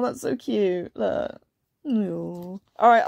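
plain reading voice

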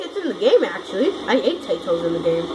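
ordinary talk, nothing marked

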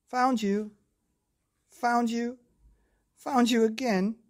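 high, loud playful shout; triumphant calling. Acted. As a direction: crow each find like a child seeker. Seeker calls out finds.

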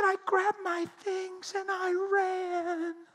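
high, slow, crying voice; tearful confession. Retold or imitated. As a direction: she flees in shame, weeping. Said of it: "She's crying".

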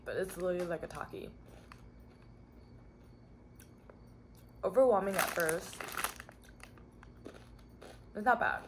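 munching between words; talking while chewing. Real, not acted. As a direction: mouth partly full of chips. Seen 2: Bites chip before, hand at mouth and chewing during speech.

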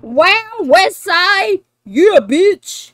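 loud, high triumphant shouting; excited. Real, not acted. Celebrating the solved West Side lyric, excited. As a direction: celebrate at full volume.

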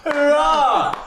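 cheering. Real, not acted. Cheering a result.

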